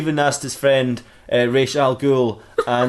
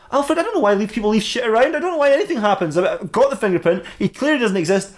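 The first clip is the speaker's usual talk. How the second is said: exasperated; 9 semitones higher than usual. Acted. Batman exasperated with Alfred.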